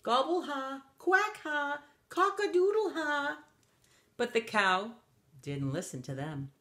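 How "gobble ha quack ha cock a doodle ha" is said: high turkey, duck and rooster laughs; mocking bird laughter. A narrator's reading. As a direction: jeer in rising farmyard calls. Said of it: "the duck laughed and laughed".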